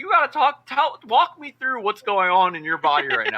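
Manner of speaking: playful therapist demand; mock therapist. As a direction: coax him like a therapist. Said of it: "I'm like".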